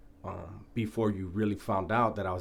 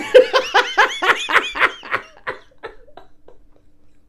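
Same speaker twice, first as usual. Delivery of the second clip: high, airy wheezing laugh; breathless laughter; 13 dB louder than usual. Real, not acted. After the punchline about Bad Boy, wheezing laughter. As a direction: laugh until no voice is left.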